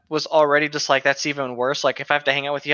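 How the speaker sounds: usual speaking voice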